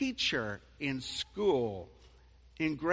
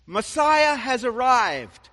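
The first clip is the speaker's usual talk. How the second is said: shouting; 8 semitones higher, 13 dB louder, slower than usual. Real, not acted. Preacher's shouted climax.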